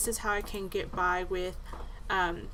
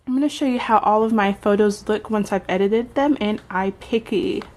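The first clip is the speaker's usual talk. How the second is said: with braces; 10 dB louder than usual. Real, not acted. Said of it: "my braces are making me sound weird".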